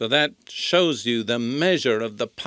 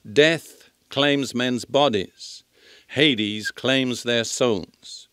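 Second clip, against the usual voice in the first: slow emphasis; much slower than usual. Acted.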